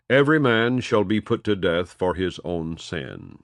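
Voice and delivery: ordinary delivery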